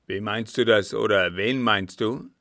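put-on loud, threatening old captain; furious interrogation; utmost anger. A narrator's reading. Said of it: "in Augenblicken des höchsten Zornes eigen war".

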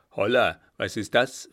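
loud, startled challenge; feigned surprise. Acted. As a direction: call out as if just stumbling on something. Feigns surprise at finding someone. (gespielt überrascht)

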